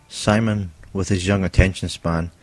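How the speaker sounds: usual speaking voice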